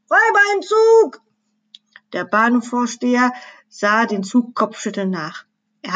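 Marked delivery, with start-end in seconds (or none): 0.1-1.2 very high, loud alarm call; desperate shout. A narrator's reading. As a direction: cry out a warning in desperation. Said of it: "nur verzweifelt zurufen". (verzweifelter, hoher Warnruf)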